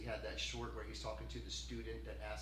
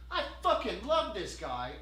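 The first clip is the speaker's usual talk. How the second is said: loud, high, slow and heartfelt; genuine, wholehearted; 8 semitones higher, 10 dB louder, slower than usual. Shown as a demonstration. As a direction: belt it out with total conviction. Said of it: "actually genuinely mean it wholeheartedly".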